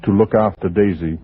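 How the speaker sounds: low, measured radio narrator; story narration. A narrator's reading. Narrator recounting Ellen's decision, measured storytelling. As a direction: tell it steadily over the music.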